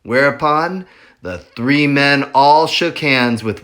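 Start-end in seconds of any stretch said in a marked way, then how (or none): none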